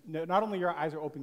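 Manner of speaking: ordinary talk, nothing marked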